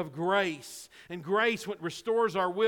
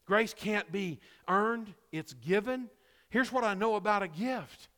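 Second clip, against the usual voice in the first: normal talking voice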